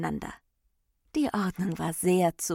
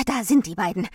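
loud, raised alert; calling out; 3 semitones higher, 8 dB louder, faster than usual. A narrator's reading. Aufmerksamkeit points Abstand to the two, loud call. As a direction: call out the sighting. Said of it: "rief die Aufmerksamkeit".

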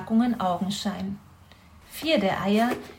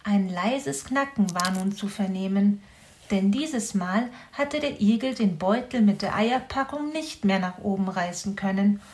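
plain reading voice

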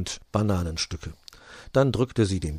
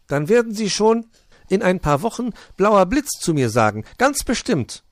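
much higher, louder welcoming owl; high friendly owl voice with a huhu; 12 semitones higher, 8 dB louder than usual. Acted. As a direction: hoot a welcome at the door. Owl teases the bird.

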